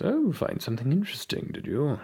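lightly voiced, lilting character question; Ullman. Ullman asks the player what he found, knowingly. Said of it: "Ullman goes".

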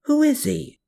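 high, mildly alarmed question. A narrator's reading. Woman questioning him about a stranger, alarmed. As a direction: demand an answer with some alarm. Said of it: "asserted with alarm".

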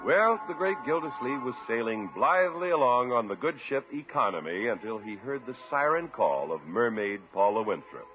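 ordinary talk, nothing marked

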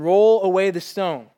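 slow, loud, ringing command. Acted. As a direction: call it out with authority. Preacher voicing Jesus' command.

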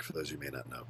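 muttered aside. Real, not acted. Repeating the aside as a throwaway.

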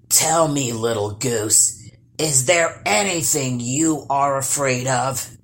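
low troll voice asking slyly. Acted. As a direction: sound out her fears as a curious troll. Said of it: "he asked".

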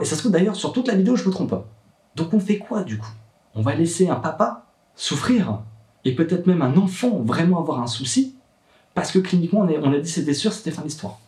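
high-pitched, pressing challenge; indignant appeal. Real, not acted. Father challenging doctors' certainty for his child, impassioned and indignant. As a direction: argue it urgently, pitched well up.

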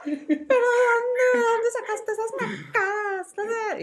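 high, very slow wailing mother; mother wailing in horror at the hairdo; horrified mom. Retold or imitated. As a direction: wail it like a scandalized mom. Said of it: "Y mi mamá así".

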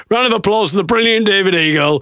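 high, mock announcer call; imitated promoter. Promoter hails the comedian, high mock announcement. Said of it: "The promoter says".

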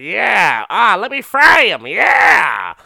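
loud, high gleeful shout; high excited whoop; gleeful eager yell. Retold or imitated. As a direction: yell it like you can't wait to fry him. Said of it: "She's like".

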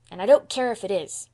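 put-on brash boyish retort; reckless retort. A narrator's reading. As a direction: throw it back recklessly. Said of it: "retorted Davy recklessly".

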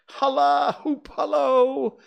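high, loud, drawn-out whoops; excited hallooing. A narrator's reading. Scrooge cheering Friday on, calling out loudly. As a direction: holler after someone running away.

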